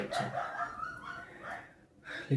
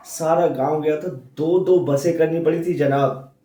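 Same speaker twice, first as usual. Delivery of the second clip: louder, swelling with pride; proud excitement; 3 semitones higher, 9 dB louder than usual. Acted. Husband boasting of his wedding procession to a visitor, excitedly. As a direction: brag happily about the big wedding.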